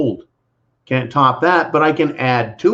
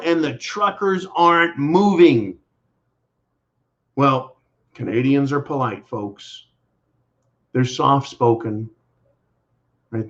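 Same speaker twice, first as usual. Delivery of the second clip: ordinary delivery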